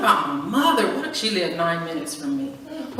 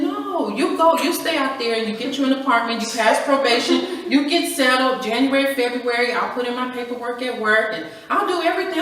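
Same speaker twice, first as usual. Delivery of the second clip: insistent; 6 semitones higher, 4 dB louder than usual. Retold or imitated. Arguing with husband.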